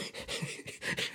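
a quiet breathy chuckle at a funny job title; soft laugh. Real, not acted. Host amused by the job title fudge tooler, soft chuckle. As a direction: let a little laugh slip out.

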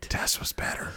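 hushed conspiratorial whisper. Real, not acted. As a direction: whisper it behind a hand. Whispered aside about a rival.

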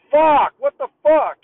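loud, slow furious shout; angry yell. Retold or imitated. Man finding a pissed-on toilet seat yells. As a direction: yell it out in disgust. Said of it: "he's, like, hella mad".

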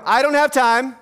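call-and-response prompt. Shown as a demonstration. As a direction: call it out for them to repeat. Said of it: "ask you to repeat after me".

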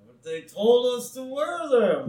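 put-on high, whiny kid voice; Bart's kid voice. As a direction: protest like a cartoon boy. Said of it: "And he's like, uh".

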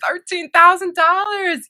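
high, slow, drawn-out disbelief; incredulous. Retold or imitated. Job seeker hearing the starting salary offer, incredulous. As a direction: echo the figure back in mock shock.

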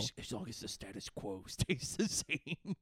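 low, soft put-on voice; film character voice. Quoting the film's catchphrase, low put-on voice. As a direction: intone it low, breaking into a chuckle. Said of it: "He's like".

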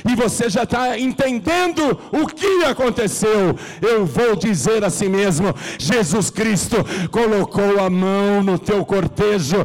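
high, loud preacher's cry; fervent preaching. Acted. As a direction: preach it out to the whole church. Preacher at full force. (pregação inflamada em voz alta)

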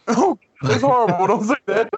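high laughing protest. Real, not acted. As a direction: laugh while scolding. Laughing at the coughing joke.

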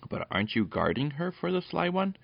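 high-pitched astonished question; surprised cry. A narrator's reading. Peter learns the dog has not got Fenella, surprised. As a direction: ask it in sudden surprise, voice lifted. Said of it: "cried Peter in surprise".

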